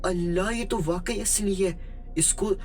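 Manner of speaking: high-pitched, crazed squealing with spoken ha-ha laughs; hammed-up mad delight, high but not truly shouted; manic excited glee. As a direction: act out a crazy girl overjoyed at her find. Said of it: "पागलों जैसी हंसी में चीख चीख कर बोलने लगी".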